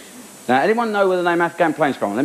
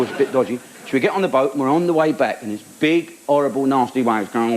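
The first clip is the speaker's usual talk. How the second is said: ordinary talk, nothing marked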